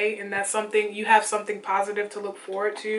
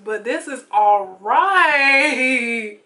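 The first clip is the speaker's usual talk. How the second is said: very slow, louder, drawn out; sassy approval; 2 semitones higher, 6 dB louder, much slower than usual. Pretend. Vlogger to viewers, slow satisfied diva approval. Admiring highlighter in mirror.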